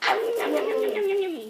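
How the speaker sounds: high, loud greedy yum yum; playful yum. Acted. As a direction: chant yum with glee. Eating the candy bride.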